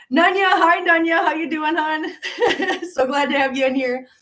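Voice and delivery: beam and laugh at the name; laughing welcome to a viewer; excited greeting. Real, not acted.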